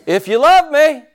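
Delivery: high, loud pulpit shout; big high exclamation to the congregation; preacher's shout. Shown as a demonstration. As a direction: shout it out, drawing out the last words. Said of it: "shout, "I love the Lord".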